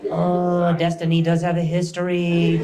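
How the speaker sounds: lightly voiced skeptical sports announcer; doubtful announcer. Comedian mocks gymnastics announcers, lightly voiced doubtful tone. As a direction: sound unconvinced, like a gloomy commentator. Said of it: "they always have this tone".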